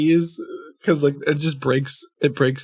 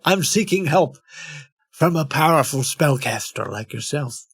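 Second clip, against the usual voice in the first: put-on supplicant. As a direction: read it as a mock seeker's plea. Restarts plea in put-on voice.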